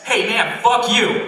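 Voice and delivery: high, loud jeer; acted heckling shout. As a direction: yell it like a heckler.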